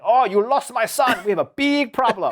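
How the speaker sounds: put-on high, laughing Japanese-accented dad; thick Japanese accent. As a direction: imitate a flustered dad with a heavy Japanese accent. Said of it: "very thick Japanese accent".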